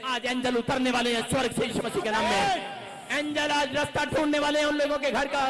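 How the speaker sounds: loud, rising preacher's call; preaching. Acted. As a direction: proclaim it to the congregation. Preaching at full force. (ज़ोरदार प्रचार)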